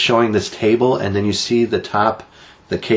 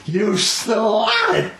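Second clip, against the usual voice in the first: high, shouted mock scandalised cry; mock outrage; 12 semitones higher than usual. Host pretending to be shocked by cohost's joke, high mock shout.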